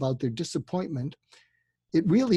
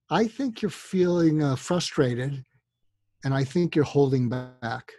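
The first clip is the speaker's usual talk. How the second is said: 1 slow calm; slower than usual. Shown as a demonstration. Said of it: "the fud reaction".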